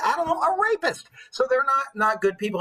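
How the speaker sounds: ordinary talk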